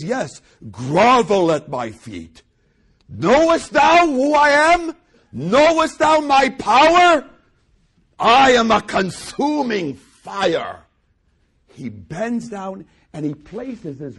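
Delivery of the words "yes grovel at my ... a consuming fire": booming, much higher and louder, slow; imagined lordly Jesus booming at grovelling disciple; mock domineering god. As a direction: thunder like an imperious deity.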